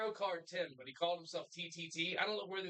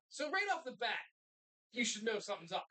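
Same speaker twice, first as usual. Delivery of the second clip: ordinary delivery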